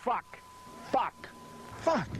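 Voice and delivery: furious swearing rising in pitch; escalating curses. Real, not acted. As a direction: build the frustration curse by curse. Botched take, rising frustration.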